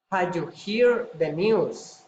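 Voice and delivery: ordinary talk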